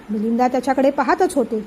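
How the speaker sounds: ordinary delivery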